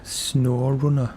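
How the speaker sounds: SnowRunner slow and put-on, said as "snoh-roonah"; bad accent. Shown as a demonstration. Streamer playing with the game's name, hammed-up accent. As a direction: ham up SnowRunner in a silly foreign accent. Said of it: "saying SnowRunner in various bad accents".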